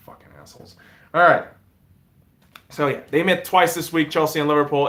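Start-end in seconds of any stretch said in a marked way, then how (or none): 0.1-0.8 whispered under the breath; muttered aside. Real, not acted. Host slipping an insult after apologising to viewers, whispered. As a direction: sneak the insult out in a hush.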